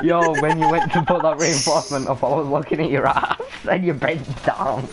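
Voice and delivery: talking through real laughter; amused, words riding on the laugh. Real, not acted. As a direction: tease a mate while cracking up. Teasing a teammate while cracking up.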